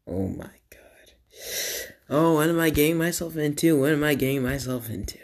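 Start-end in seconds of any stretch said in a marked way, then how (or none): 0.1-1.9 breathy cringing whisper; mortified whisper. Real, not acted. Cringing at old videos.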